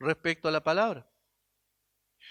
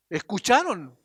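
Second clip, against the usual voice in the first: ordinary delivery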